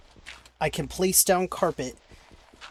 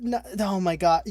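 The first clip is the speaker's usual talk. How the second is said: ordinary talk, nothing marked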